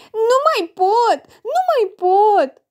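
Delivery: slow, high, loud mock crying; crying child. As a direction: wail it like a tearful little girl. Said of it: "plânge Betina".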